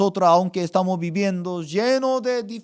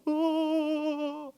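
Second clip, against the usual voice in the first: high, drawn-out mock sob; imitated crying; 9 semitones higher, 6 dB quieter than usual. Pastor imitates sister crying on a call, mock wail. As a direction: wail without words like a weeping woman. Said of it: "estaba llorando".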